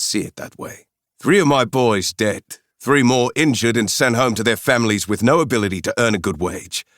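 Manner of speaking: put-on sarcastic South African accent, rising with anger; bitter South African. Mercenary recalls his dead men in Niger, bitter South African accent. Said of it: "With a strong South African accent".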